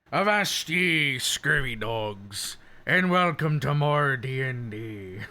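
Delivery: slow, drawn-out pirate welcome; pirate accent. Acted. Streamer welcoming viewers to D and D, pirate accent. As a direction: attempt a hearty pirate accent. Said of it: "my attempt at a pirate accent".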